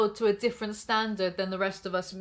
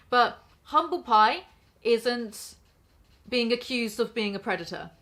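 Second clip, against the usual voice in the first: ordinary delivery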